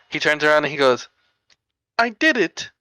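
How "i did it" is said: imitated squeaky game character; high cartoonish skeleton voice; super high-pitched voice. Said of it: "we made his voice, like, super high pitched".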